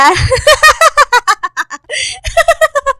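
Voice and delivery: high mocking villain laugh; gloating laugh. Rival gloats at the jilted friend, high mocking laugh. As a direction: cackle in triumph over her rival. Gloating over pregnancy news.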